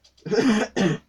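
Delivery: sharp high hacking cough; loud real cough from inhaled dust. Real, not acted. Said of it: "Oh god, I just inhaled dust".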